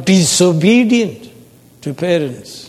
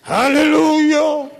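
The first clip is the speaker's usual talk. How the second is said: high, ringing cry of praise; shouted hallelujah; 13 semitones higher, 4 dB louder than usual. Acted. Preacher voicing the heavenly multitude from Revelation, high praise shout. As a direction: shout it like a great multitude, pitched way up. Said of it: "loud peals of thunder shouting".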